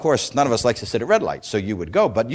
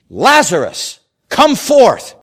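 loud, high, commanding call; whip-like command; 10 semitones higher, 8 dB louder, much slower than usual. Acted. Jesus calling Lazarus out of the tomb, whip-like command. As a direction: crack it like a whip. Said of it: "the whip-like authority of those words".

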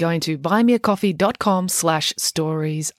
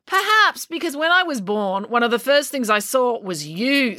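higher-pitched, cutting comeback; scathing retort; 6 semitones higher than usual. A narrator's reading. April answers Finn's accusation with a scathing retort. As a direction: retort sharply in a raised pitch.

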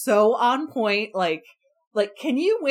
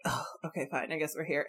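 resigned mumble; 5 semitones lower, 12 dB quieter, faster than usual. Retold or imitated. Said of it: "Isabella is just like".

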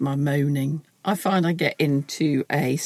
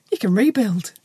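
annoyingly upbeat; 4 semitones higher than usual. Pretend. Mock cheery advice continues.

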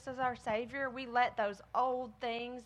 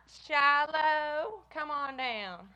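long, drawn-out name, louder and higher; calling out; 4 semitones higher, 4 dB louder, much slower than usual. Real, not acted. Teacher calling Shiloh to come down, drawn-out call. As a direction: sing out the name to summon someone.

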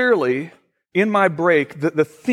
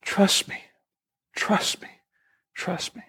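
soft, voiceless whisper of the Spirit; 2 semitones higher, 6 dB quieter, slower than usual. Retold or imitated. The Spirit speaks to the praying pastor, whispered. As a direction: whisper it as a divine prompting. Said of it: "the whisper of the Spirit said to me".

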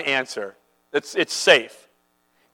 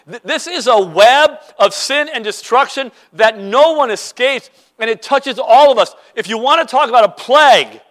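louder, higher preaching build; rising preaching; 4 semitones higher, 5 dB louder than usual. Acted. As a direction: drive the point home to the pews.

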